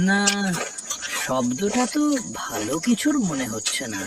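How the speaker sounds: put-on an uneasy old fisherman muttering to himself at night; a fisherman fretting that his fish will be stolen, voice rising with worry; worried fisherman. Alone at the fish pond at night, a worried fisherman talks himself into checking.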